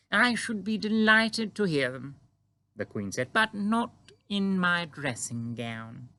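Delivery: Queen greeting the giant calmly; composed Queen. A narrator's reading. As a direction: stay perfectly poised, royal. Queen voiced in story.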